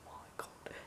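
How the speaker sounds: breathless whisper of disbelief; awestruck whisper. Retold or imitated. Starstruck fan as the artist signs her prints, whispering. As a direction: whisper it, barely able to speak.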